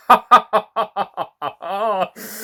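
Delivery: high bubbling giggle; a bright, high little laugh; delighted giggle. Real, not acted. Storyteller giggling at the huge chocolate gift.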